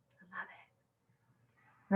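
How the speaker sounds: slow breathy whisper of love. Real, not acted. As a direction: whisper it like a secret. Loving the little knife.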